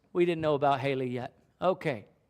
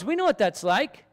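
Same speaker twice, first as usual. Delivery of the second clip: usual speaking voice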